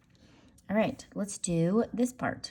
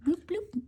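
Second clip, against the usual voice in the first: sound effects; 11 semitones higher than usual. Pretend. Sound effects while drawing.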